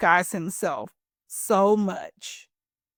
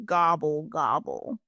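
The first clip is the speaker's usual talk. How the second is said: rumbling gobble; 5 semitones lower than usual. Acted. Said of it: "rumbled Turkey".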